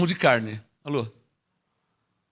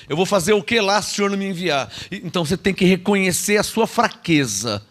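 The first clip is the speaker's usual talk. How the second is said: usual speaking voice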